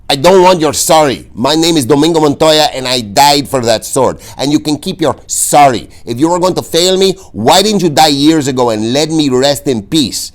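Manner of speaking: high, loud furious rebuke; angry father. A narrator's reading. Dead father berating his failing son, loud and angry. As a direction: scold him with bitter fury.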